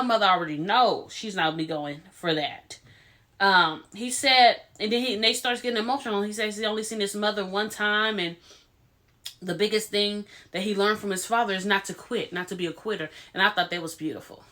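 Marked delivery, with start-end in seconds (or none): none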